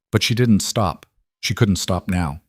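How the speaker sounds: plain reading voice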